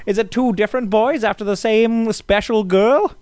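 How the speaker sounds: high, eager studio executive; old-timey producer voice. Pretend. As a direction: pitch it like a movie mogul. Joins producer impression.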